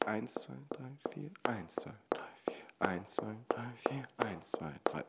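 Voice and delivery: leise, halb geflüstert, eins betont; Vierertakt zählen. Shown as a demonstration. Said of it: "Das entspricht einem Viervierteltakt". (Vierertakt flüsternd zählen)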